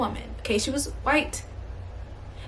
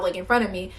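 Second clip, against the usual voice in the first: normal talking voice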